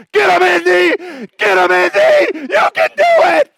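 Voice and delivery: put-on very high, loud cheering boy; excited kid shout. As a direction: yell at the movie screen. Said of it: "starts walking down the aisle".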